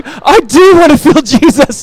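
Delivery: high, loud, slowed, laughing through the words; laughing eagerly. Retold or imitated. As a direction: answer the boy with delighted laughter. Dad moved, laughing reply.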